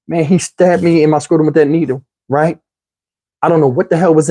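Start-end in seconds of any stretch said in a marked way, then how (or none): none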